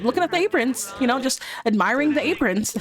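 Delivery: high, sheepish cover story; guilty man pretending to admire aprons; caught-out innocence. Roman caught, playing it off.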